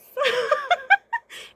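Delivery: loud, high squealing laugh; real delighted squeal of laughter; high burst of laughter. Real, not acted. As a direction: crack up in a shriek. Laughing at old man.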